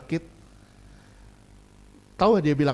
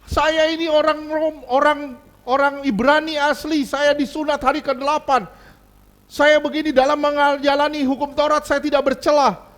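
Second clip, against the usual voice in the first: proud Paul; 7 semitones higher, 4 dB louder than usual. Retold or imitated. Paul boasting of his credentials, proud higher declaration. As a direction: announce your pedigree with pride. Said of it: "gimana Paulus narsisisnya".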